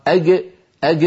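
أج said as a hard g at the back; Egyptian hard g. Shown as a demonstration. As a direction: pull أج back to a g. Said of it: "كما ينطقها في العاميات". (جيم قاهرية خلفية)

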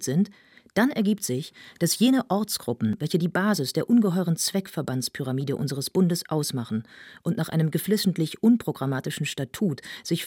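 earnest, insistent speech-making; lecturing young intellectual. Acted. Young idealist lectures a crowded assembly, declaiming. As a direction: drive through a long-winded lecture, building in force.

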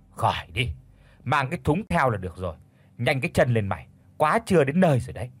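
quick, rushed orders to the maid; hurrying. Acted. Mistress hurrying the maid to market, fast impatient orders. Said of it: "Mụ Bền thì hối thúc".

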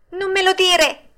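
high, loud puppet cry; high-pitched scared exclamation; frightened yell. A narrator's reading. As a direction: cry out in leftover fear. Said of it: "urlò Pinocchio, che tremava ancora dalla paura".

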